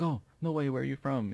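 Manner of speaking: high, excited surprise; surprised Swede. Retold or imitated. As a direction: perk up with delighted surprise. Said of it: "and they're like".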